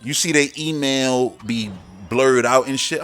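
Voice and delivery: ordinary talk, nothing marked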